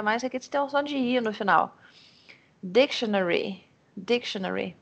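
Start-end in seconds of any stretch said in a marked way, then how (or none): 2.6-4.8 "Dictionary" with the first syllable "dic" strongest; stress on DIC. Shown as a demonstration. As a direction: lean on "dic", then carry the breath to the final "-ry". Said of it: "a primeira sílaba".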